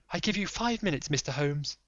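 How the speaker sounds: lady pleading secrecy; Lady Hilda. Acted.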